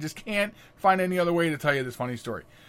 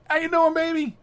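high, louder hello to a loved one; affectionate call-out, pitched way up; playful greeting; 14 semitones higher, 4 dB louder than usual. Real, not acted. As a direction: greet your daughter in a raised, playful voice. Greets daughter joining the live show.

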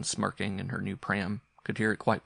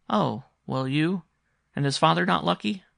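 put-on high, louder child's questioning; eager boy; 8 semitones higher, 6 dB louder than usual. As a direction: press the question like a curious boy. Boy questions his mother.

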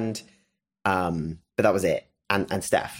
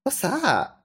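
high, puzzled question; fiancée asking about the bag, lightly mimicked high; curious girlfriend; 14 semitones higher than usual. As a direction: imitate her wondering what's in the bag. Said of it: "She goes".

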